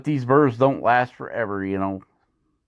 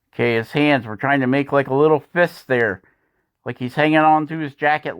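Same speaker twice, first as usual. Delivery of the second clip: usual speaking voice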